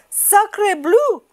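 high French-exclaiming duck; excited cry. A narrator's reading. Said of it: "she cried".

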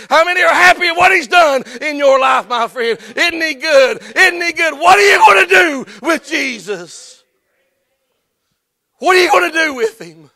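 loud, high preaching cry; preaching climax. Acted. Preacher rousing the congregation, loud and high. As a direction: drive it home to the crowd.

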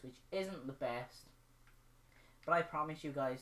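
ordinary talk, nothing marked

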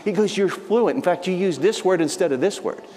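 high, eager retelling of a man in tears; imitating an overwhelmed man who can hardly believe it; moved, excited missionary. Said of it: "This guy's in tears".

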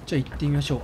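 ordinary talk

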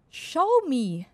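loud English command to the mic. Shown as a demonstration. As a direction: bark it into the mic. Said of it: "Ich muss das englischer sagen".

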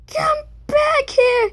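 high, drawn-out pleading shout; desperate high cry. Real, not acted. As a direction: wail after it as it escapes. Chasing a cell in game.

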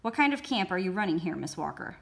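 plain reading voice

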